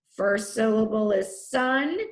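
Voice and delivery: ordinary delivery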